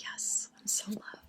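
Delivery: very soft, whispered contentment; blissful whisper. Retold or imitated. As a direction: whisper it as if basking in love. Acts out her blissful days.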